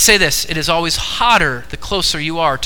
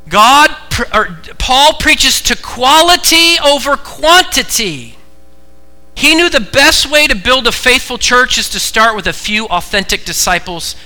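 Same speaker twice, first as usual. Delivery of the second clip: loud, high preacher's emphasis; full-force preaching; 9 semitones higher, 7 dB louder than usual. Real, not acted. Preacher correcting the congregation's idea of success, full-force preaching. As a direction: drive it home from the pulpit.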